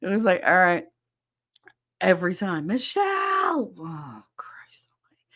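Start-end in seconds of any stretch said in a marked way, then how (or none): 2.6-3.7 high, drawn-out mock holler; imitated call for someone. Imitates being yelled for every time. 3.8-5.2 very soft, slow grumble under the breath; exasperated mutter. Retold or imitated. Her weary reaction to being called.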